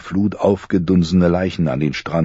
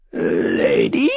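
croaked question like a raven; croaking raven. A narrator's reading. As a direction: croak it out, bird-like. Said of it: "krächzte er".

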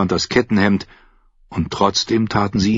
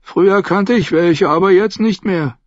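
higher, tearful and broken; sobbing; 10 semitones higher than usual. A narrator's reading. Sam sobs to Grenn that he knows no songs, higher and tearful. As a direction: choke it out through tears. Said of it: "Erneut schluchzte er".

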